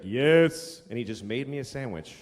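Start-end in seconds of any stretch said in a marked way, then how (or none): 0.0-0.8 curt counterman. Retold or imitated. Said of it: "he's like".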